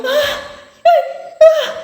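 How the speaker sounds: very high, loud panicked cries; terrified scream. Retold or imitated. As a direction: shriek in terror. Terrified scream after the gunshot. (gritos de pavor, bem agudos)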